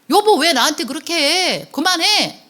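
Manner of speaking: high, mildly exasperated acted protest; acted wife's protest. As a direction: play a wife pushing back at her husband. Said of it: "그렇게 화를 내면 아내도 어떻게 될까요". (살짝 높은 소리로 맞서는 아내 흉내)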